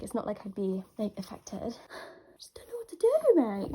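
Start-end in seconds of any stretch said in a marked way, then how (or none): 2.4-3.7 high, loud breathy panic; overwhelmed, almost squealing; frantic whisper. Real, not acted. Fan overwhelmed by album news, frantic whisper.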